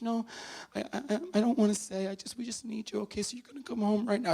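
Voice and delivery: high, stammering, upset pleading; imitating tearful sister-in-law. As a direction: stumble over it as if crying on the phone. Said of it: "she's on the line and she's crying".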